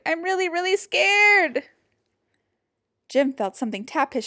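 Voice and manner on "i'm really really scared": high, loud frightened wail; scared scream. A narrator's reading. Jim admits his fear, screaming. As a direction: wail it out, building to the last word. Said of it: "he screamed".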